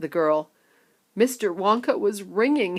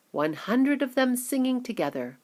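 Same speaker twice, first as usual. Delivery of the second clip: ordinary delivery, nothing marked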